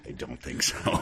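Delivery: breathy whispered aside, deadpan; muttered disbelief. Real, not acted. Co-host whispering his doubt about the book's plot, a dry aside. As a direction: whisper it like a skeptic talking to himself.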